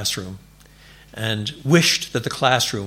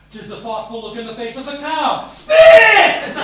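teacher's shouted punchline; 13 semitones higher, 8 dB louder than usual. Retold or imitated. Old army teacher yelling at a gum-chewing boy, loud shout. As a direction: shout it high and loud, ending in a bark of "Spit!". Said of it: "and recite".

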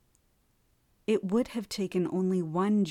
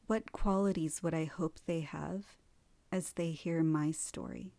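guided meditation. Acted. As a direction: lead them gently into stillness.

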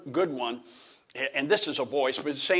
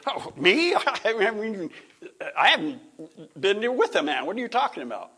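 astonished Mary; 6 semitones higher than usual. Acted. Mary answering the angel Gabriel, high and laughing in disbelief. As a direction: play Mary caught off guard, half-laughing.

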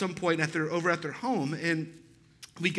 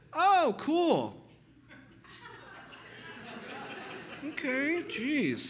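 high, airy fake cheer; high mock surprise; 9 semitones higher, 4 dB quieter, much slower than usual. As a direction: act politely put off, voice up high. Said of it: "it kind of went like this".

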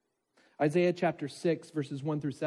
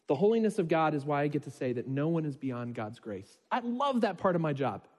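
normal talking voice

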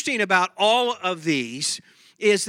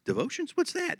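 high, soft mock bewilderment; mock puzzled; 9 semitones higher, 8 dB quieter than usual. Pastor playing a congregant who never heard of devotions, high mock puzzlement. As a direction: play dumb about devotions, pitched up.

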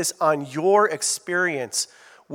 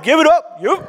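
high, loud burst of praise; loud preacher's cheer; excited cheer; 10 semitones higher, 6 dB louder than usual. Real, not acted. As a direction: whip up the room with a big cheer. Pastor cheering a congregation answer.

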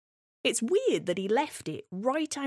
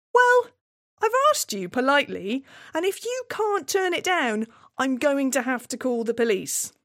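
firm warning; 6 semitones higher, 5 dB louder than usual. Retold or imitated. Warning the dwarf.

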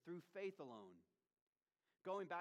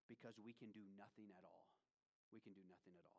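low, much softer, solemn preaching; hushed emphasis; 5 semitones lower, 10 dB quieter, faster than usual. Real, not acted. Preacher asks listeners to listen to Calvin's point, hushed. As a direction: drop right down so they lean in.

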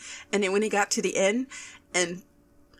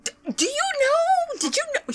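very high, squealed questions; frantic questioning; 3 dB louder than usual. Retold or imitated. Fan quizzes second mom in the theater, frantic questioning. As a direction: squeal it, desperate to be told.

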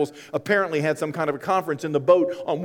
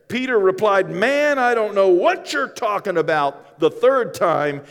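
louder, higher, slowing retelling; building preaching; 6 semitones higher, 5 dB louder than usual. Acted. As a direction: build the story to its peak.

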